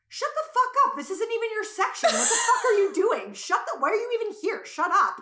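loud, high-pitched acted yelling; mad outburst. Storyteller re-enacting her blow-up at a classmate, loud and mad. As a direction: replay a furious telling-off with laughter creeping in. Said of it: "Like, I was so".

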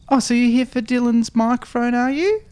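high, sing-song mum at the door. Retold or imitated. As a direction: play the friendly mum.

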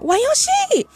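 put-on high-pitched excited child voice; eager kid. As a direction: perk up like a curious toddler.

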